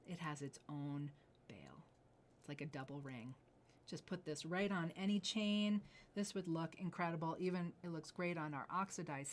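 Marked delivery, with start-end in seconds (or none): 0.1-3.3 whisper. Real, not acted. Host pointing out detail.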